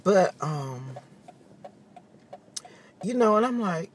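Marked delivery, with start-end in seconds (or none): none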